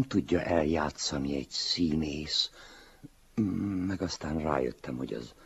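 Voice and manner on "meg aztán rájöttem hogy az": plain reading voice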